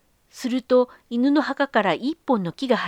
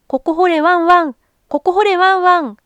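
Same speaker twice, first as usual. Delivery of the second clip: high, loud sing-song dog voice; dog's call; 8 semitones higher, 10 dB louder than usual. A narrator's reading. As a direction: bark it out like a dog calling. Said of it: "犬の声がそんな風に聞こえました".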